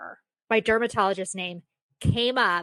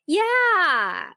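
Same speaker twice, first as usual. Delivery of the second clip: eager yeah; 9 semitones higher, 5 dB louder than usual. Retold or imitated. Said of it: "So I just go".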